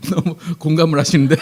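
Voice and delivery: amused, laughter breaking through; laughing remark. Real, not acted. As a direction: talk through a real laugh. Amused by congregation's reaction.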